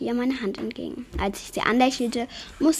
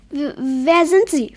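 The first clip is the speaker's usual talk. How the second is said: stammering, higher and louder in fright; horrified; 5 semitones higher, 7 dB louder than usual. A narrator's reading. Yeti startled by the intruder, stammering in horror. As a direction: gasp it out, terrified of the stranger. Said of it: "fragte sie entsetzt".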